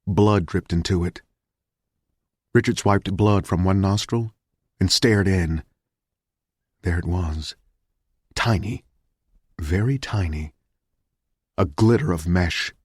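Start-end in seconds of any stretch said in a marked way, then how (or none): none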